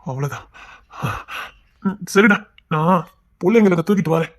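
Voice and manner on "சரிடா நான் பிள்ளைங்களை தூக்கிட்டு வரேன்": ordinary delivery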